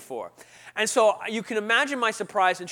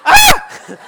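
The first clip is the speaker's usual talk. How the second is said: very high, loud shriek; short comic scream of horror; startled scream; 20 dB louder than usual. Retold or imitated. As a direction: scream in mock alarm. Mock fright at second Bible.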